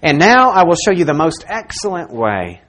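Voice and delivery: loud, emphatic Bible reading; scripture proclamation. Acted. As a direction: proclaim the verse forcefully to the whole congregation. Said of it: "God tells us this".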